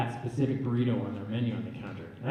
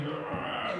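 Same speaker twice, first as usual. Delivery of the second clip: strained growl without voice; wrestling grunt; 7 dB quieter than usual. Retold or imitated. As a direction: growl as if grappling. Imitates wrestling in movie.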